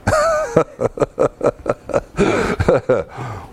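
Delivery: a long, breathy chuckle at the thought; amused laugh. Real, not acted. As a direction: laugh at the miserable camping memory. Bad camping outing amuse.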